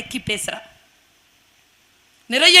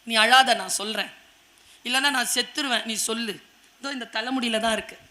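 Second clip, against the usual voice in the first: quiet, much softer confession; soft surrender; 3 semitones lower, 7 dB quieter than usual. Acted. Samson softly revealing the secret to Delilah. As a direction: give in gently.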